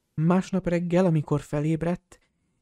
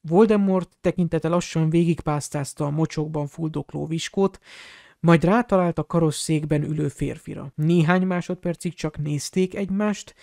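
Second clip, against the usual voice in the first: usual speaking voice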